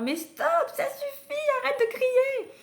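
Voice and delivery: shrill-edged mock parent outburst; stressed parent yelling. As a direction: yell at the child to stop screaming. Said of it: "on se met à hurler en disant".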